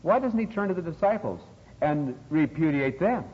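usual speaking voice

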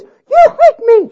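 high, slow howl of hurt. A narrator's reading. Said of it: "she howled".

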